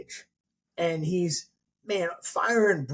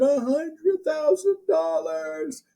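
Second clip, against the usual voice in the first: mock crowd answer; 13 semitones higher, much slower than usual.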